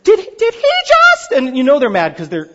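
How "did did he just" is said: high, loud, squealing disbelief; hammed-up angry shock, laughing; mock outrage. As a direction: rabbis shrieking in mock indignation. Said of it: "They'd be like".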